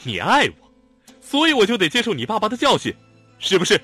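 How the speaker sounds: shouting. A narrator's reading. Xiao Tong yells back at Lanlan, cornered and loud. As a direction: yell it back at her. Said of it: "冲他叫喊".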